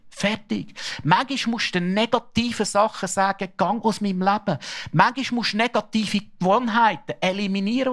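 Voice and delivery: the preacher urges the church, preaching full force. Acted. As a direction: drive it home to the whole room. Exhorts the congregation.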